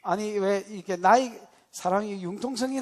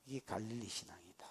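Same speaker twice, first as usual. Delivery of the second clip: hushed whisper; 9 semitones lower, 17 dB quieter than usual. Real, not acted. Preacher drops to hushed aside.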